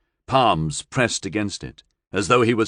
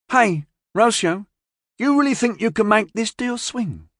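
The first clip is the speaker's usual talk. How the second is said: put-on higher young woman's voice; Beth's voice; 13 semitones higher than usual. A narrator's reading. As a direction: lift the voice for Beth. Beth voiced by narrator.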